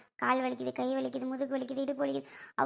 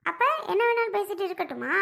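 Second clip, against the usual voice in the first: higher, outraged challenge; indignant retort; 6 semitones higher than usual. Acted. Mother-in-law retorting to her son-in-law, indignant and high. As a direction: fire the question back in disbelief.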